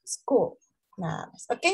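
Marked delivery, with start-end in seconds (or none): 0.0-0.6 "School" with a light dark final l; very soft L. Shown as a demonstration. Teacher models the correct word, soft final L. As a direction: soften the end of "School" almost to "skoo". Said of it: "pero bien suavecita".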